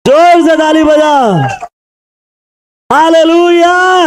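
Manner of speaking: rally the congregation at full voice; fiery preaching. Acted.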